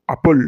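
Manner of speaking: "আপেল" said firmly with a round opening vowel; short, solid "আপেল"; not soft, round. Shown as a demonstration. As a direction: punch out "আপেল", nothing soft about it. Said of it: "অত মোলায়েমভাবে বলার কি আছে?".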